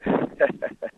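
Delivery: brief unvoiced chuckle; short laugh. Real, not acted. Ex-player asked if he'd play for Málaga, short amused laugh. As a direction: chuckle briefly before answering. Amused by question about playing again.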